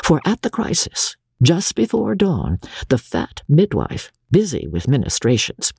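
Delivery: plain reading voice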